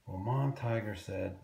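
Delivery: usual speaking voice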